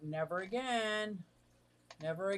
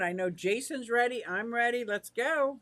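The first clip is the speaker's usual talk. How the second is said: usual speaking voice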